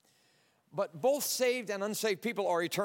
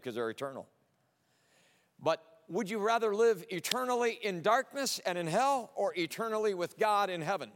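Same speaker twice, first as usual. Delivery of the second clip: ordinary delivery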